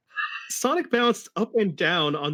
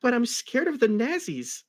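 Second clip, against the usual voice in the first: put-on scared girl voice; 8 semitones higher than usual. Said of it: "almost ready to cry".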